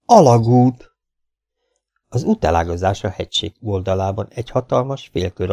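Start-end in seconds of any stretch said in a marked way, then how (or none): 0.0-0.9 a loud, startled cry of one word; shouted discovery. A narrator's reading. Both explorers spot the tunnel at once, shouting it. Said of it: "egyszerre kiáltottak föl".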